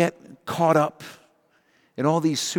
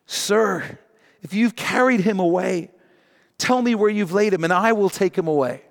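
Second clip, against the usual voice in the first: raised, pleading voice to the gardener; a grieving woman pleading, pitched up; urgent plea; 6 semitones higher, 3 dB louder than usual. Acted. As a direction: beg him to tell you where.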